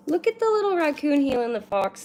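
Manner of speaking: high, loud gushing over something cute; cutesy cooing. Real, not acted. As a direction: talk like to a puppy. Cooing over cute card art.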